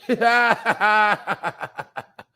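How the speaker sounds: loud open cackle, tapering off; derisive laugh. Real, not acted. Laughing at viewers who bought that stuff, derisive cackle. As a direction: burst out laughing, let it trail away.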